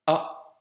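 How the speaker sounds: A with a small catch in the throat before it; A opened by a glottal stop. Shown as a demonstration. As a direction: stop the breath, then release A. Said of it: "ça se lit".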